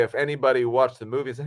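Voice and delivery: usual speaking voice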